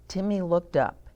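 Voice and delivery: plain reading voice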